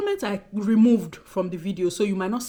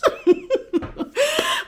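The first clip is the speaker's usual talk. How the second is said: high, loud burst of laughter; 6 dB louder than usual. Real, not acted. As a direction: crack up loudly at your own joke. Laughs at own joke, signing off.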